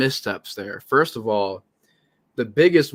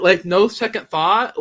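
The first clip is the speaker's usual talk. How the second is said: ordinary talk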